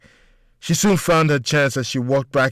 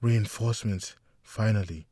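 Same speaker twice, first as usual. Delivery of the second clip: muttering; 4 semitones lower, 9 dB quieter than usual. A narrator's reading. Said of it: "she muttered under her breath".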